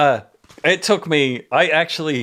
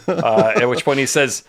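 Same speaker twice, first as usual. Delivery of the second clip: ordinary delivery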